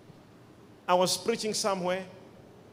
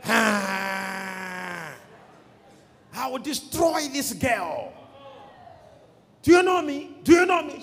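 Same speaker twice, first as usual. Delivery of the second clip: demon voice; 3 dB louder, slower than usual. Retold or imitated. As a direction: cry out, then challenge as an angry demon. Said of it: "A demon".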